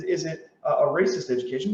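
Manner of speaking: normal talking voice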